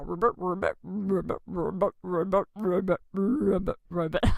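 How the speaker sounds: croaking ribbits like a playful frog; children's sermon frog croaks. Pretend.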